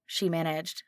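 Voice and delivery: normal talking voice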